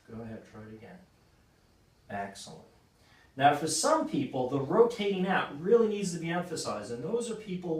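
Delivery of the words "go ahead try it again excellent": softly prompting another try; low encouragement close to the lifter; quiet coaching cue. Real, not acted. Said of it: "coach cueing client mid-rep".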